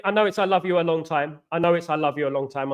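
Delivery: ordinary delivery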